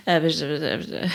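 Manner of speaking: low, quiet imitation of pretend-reading babble; toddler pretending to read. Mom imitating her son with a book, toddler pretending to read. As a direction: mumble nonsense as if reading along. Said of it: "he likes to pretend to read".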